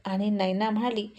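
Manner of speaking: ordinary delivery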